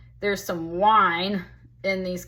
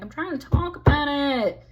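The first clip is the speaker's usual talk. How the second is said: high, loud mock exasperation; exasperated protest; 7 semitones higher, 5 dB louder than usual. Voicing the woman accused of whining, exasperated protest. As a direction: insist on being heard, pitched up. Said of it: "is what she's saying".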